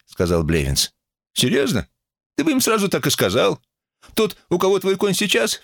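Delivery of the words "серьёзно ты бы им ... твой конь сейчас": high-pitched mocking disbelief; sarcastic retort. A narrator's reading. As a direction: jeer with exaggerated high pitch. Sarcastic retort in acted quarrel. (язвительно)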